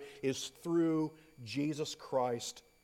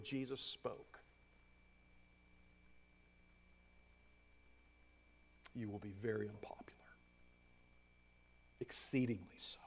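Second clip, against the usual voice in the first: hushed whisper; 6 semitones lower, 17 dB quieter than usual. Acted. Preacher drops voice for warning.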